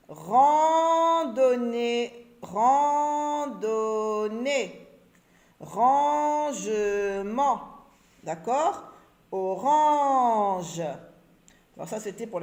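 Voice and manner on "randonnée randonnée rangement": Rangement drawn out very slowly, with the rang held long; stretched word. Shown as a demonstration. Teacher stretches Rangement for the children. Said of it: "syllabe rang".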